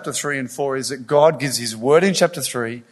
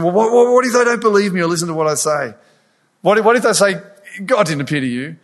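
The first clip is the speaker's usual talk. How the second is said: higher, louder, fretful Moses objecting; anxious Moses; 5 semitones higher, 4 dB louder, faster than usual. Acted. Preacher voicing Moses' frantic objections to God. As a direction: voice Moses panicking, rushing the what-ifs.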